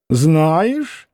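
usual speaking voice

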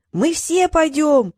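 high, slow, drawn-out shout; rallying cry. A narrator's reading. Gnome women answering Gruya's call, shouting. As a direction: stretch it out like a crowd's cry. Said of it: "закричали гномихи".

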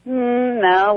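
ordinary delivery

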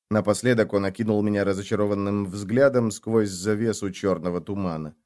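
plain reading voice

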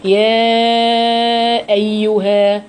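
slow chanted tajweed recitation; Quran recitation. Shown as a demonstration. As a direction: draw out the vowels, reciting. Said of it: "هقول".

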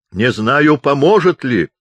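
loud, high, doubtful remark. A narrator's reading. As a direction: project it as into a megaphone.

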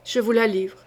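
mild shiver in the voice, giving her up; a hint of shaking as he hands her over; trembling surrender. Duke, shivering, hands the rival to the queen. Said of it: "tout grelottant".

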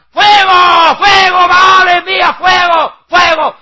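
very high, full-force mock yell; hysterical shouting. Prophets of Baal begging for fire, frenzied mimicked screaming. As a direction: scream it like a crazed worshipper. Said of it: "Y gritaban".

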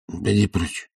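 soft sleepy grumble; grumbling. A narrator's reading. Master sending the servant away before sleeping again, grumbling. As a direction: grumble it, half asleep. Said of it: "проворчал Илья Ильич".

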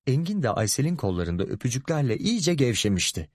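ordinary delivery, nothing marked

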